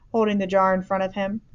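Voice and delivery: ordinary delivery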